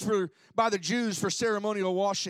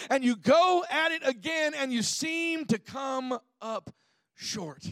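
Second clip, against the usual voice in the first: slow, rising, higher preaching build; 4 semitones higher than usual. Acted. Pastor to congregation, building a slow heartfelt preaching swell. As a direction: preach it, stretch the words.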